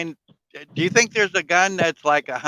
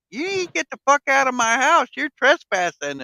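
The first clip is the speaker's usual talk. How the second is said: mock old lady; 8 semitones higher than usual. Old lady voiced in movie retelling.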